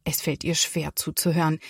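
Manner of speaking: ordinary delivery, nothing marked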